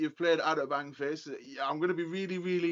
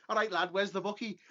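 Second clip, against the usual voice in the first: put-on Scouse accent; 4 semitones higher than usual. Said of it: "opened the front door".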